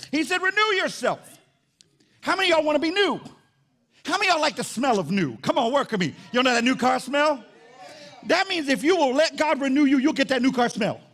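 high, loud preacher rallying the congregation; full-force preaching. Real, not acted.

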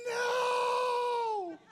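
high, loud laughing shout; laughing scream of no. Retold or imitated. Pastor shrieking as the cat comes back, loud high no. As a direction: shriek in comic horror.

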